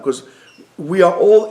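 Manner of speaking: ordinary talk, nothing marked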